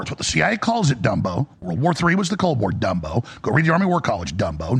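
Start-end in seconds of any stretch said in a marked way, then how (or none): 1.0-1.5 deep, scornful name-calling with a rising end; mocking insult. Real, not acted. Host taunting an online critic, low sneering Dumbo. 2.7-3.2 deep, scornful name-calling with a rising end; mocking insult. Real, not acted. Host taunting an online critic, low sneering Dumbo. 4.3-4.8 deep, scornful name-calling with a rising end; mocking insult. Real, not acted. Host taunting an online critic, low sneering Dumbo.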